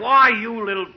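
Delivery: mock-offended outburst cut short; mock indignation. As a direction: sputter at the upstart in mock outrage. Indignant retort to McGee.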